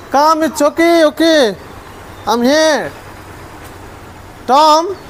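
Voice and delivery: high-pitched reassurance to a pet; high, encouraging calling; coaxing call. Real, not acted. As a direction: coax a scared pet over. Coaxing a hesitant pet to come.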